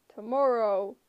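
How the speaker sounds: loud, curt giant; monster voice. A narrator's reading. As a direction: bark the deadline. Cyclops voice.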